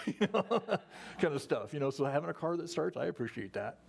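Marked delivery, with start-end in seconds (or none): 0.0-1.2 laughing. Real, not acted. Laughs about pushing car uphill.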